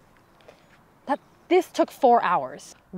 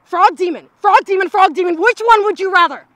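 louder and higher, furious rant; exasperated yelling; 7 semitones higher, 9 dB louder than usual. Acted. Leader yelling at her useless party, louder and higher. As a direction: blow up at the idiots around you.

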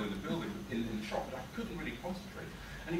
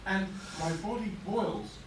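ordinary delivery, nothing marked

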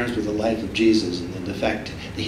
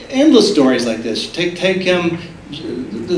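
ordinary talk, nothing marked

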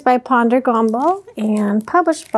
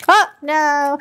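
ordinary delivery

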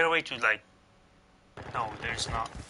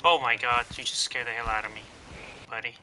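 normal talking voice

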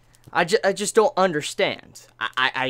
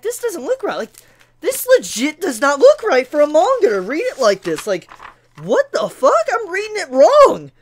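exasperated disbelief; 7 semitones higher, 6 dB louder than usual. Retold or imitated.

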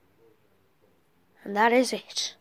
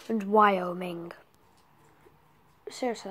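normal talking voice

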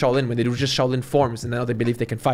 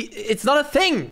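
exasperated; 12 semitones higher, slower than usual. Real, not acted. Rant rising in frustration.

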